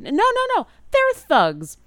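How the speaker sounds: high and loud, protesting; the bard cutting off Leogen, high and insistent; insistent bird bard. Acted.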